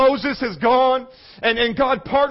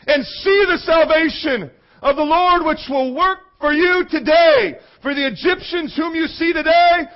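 raised, forceful battle-speech delivery; rousing scripture proclamation; 5 semitones higher than usual. Acted.

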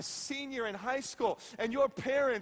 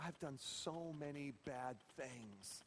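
put-on a small girl's hushed admission of guilt; hushed, near-whispered confession; 11 semitones lower, 16 dB quieter than usual. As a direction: confess it quietly, voice dropping away. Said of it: "she looked at Brad and said".

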